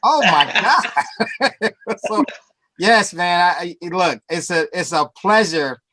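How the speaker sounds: ordinary talk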